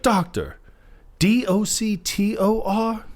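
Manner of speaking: sarcastic spelling of "Doctor" as "D O C T O R"; spelled out mockingly. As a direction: spell "D O C T O R" one letter at a time, in a slow mocking tone.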